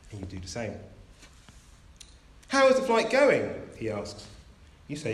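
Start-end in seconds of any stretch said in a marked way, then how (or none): 2.5-3.5 cheery captain. Pretend. Captain greets a passenger.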